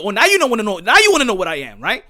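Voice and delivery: loud, fed-up retort; defiant comeback. Retold or imitated. His own retold answer to a nosy stranger, loud and fed up. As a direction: throw it back at them.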